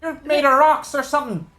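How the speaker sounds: very high, shouted cry from aloft; crow's nest lookout. Acted. Fellow high in the crow's nest to those on deck, high-pitched cry out. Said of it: "there is a fellow in the crow's nest".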